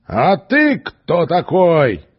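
angry strongman. Acted. Strongman snaps back at the stranger, loud and angry. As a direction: bellow it at him, offended. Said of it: "рассердился силач".

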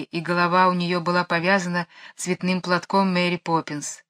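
plain reading voice

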